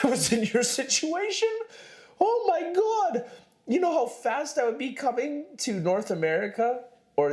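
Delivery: excited. Real, not acted. Exaggerated excitement about immigrating.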